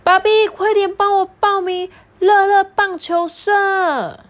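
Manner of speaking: little son pleading. Acted. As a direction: beg like an impatient little boy. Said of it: "他说".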